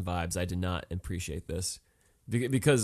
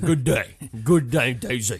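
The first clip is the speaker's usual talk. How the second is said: loud mock Southern farewell; bayou swamp man; 2 semitones higher, 7 dB louder, slower than usual. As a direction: dismiss her like an indignant bayou local. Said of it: "the guy from the bayou".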